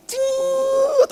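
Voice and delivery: a very high squeaky ciut; door squeak. Retold or imitated. Mimicking a luxury car door opening, high squeak.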